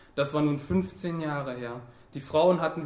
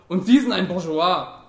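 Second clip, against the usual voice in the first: loud, pointed charge; combative accusation; 3 semitones higher, 9 dB louder than usual. A narrator's reading. Worker accuses Fabian of his class, loud and combative. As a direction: throw it at him as an insult.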